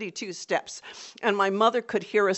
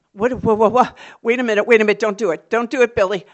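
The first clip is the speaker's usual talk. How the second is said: loud frantic pleading; loud anxious begging; panicked pleading; 3 semitones higher, 12 dB louder, faster than usual. Retold or imitated. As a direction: beg him to stop. Girl panicking as brother lights fuse.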